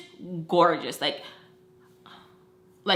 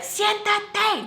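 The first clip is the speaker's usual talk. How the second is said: sharp, much higher order in Spanish; mad; 13 semitones higher than usual. Retold or imitated. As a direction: snap it out like an angry aunt. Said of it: "like, just mad".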